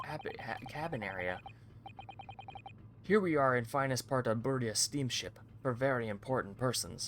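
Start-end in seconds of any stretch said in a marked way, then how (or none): 3.0-7.1 put-on louder Russian-accented boast; Russian sailor boasting. Guard brags about the VIP cabins, lightly Russian-accented. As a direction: show it off like a proud Russian guard.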